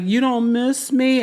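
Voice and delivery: high, slow, plaintive mock pleading; imitating Angela. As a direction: whine it like a lonely wife. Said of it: "I was just like".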